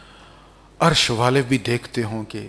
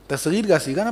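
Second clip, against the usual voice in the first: normal talking voice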